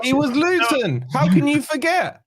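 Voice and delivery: high-pitched, indignant outburst; incredulous protest. Real, not acted. Incredulous comeback in heated argument.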